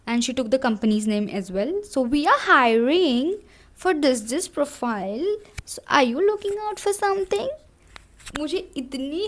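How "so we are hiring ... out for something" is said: a hint of a high, chirpy caller; lightly mimicked recruiter. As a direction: play the sweet recruiter lightly. Recruiter girl continues the pitch. (हल्की सी मीठी phone वाली आवाज़)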